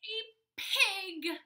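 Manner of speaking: loud, high reveal; gleeful surprise; excited reveal. A narrator's reading. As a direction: pop the answer out. Big reveal of the animal.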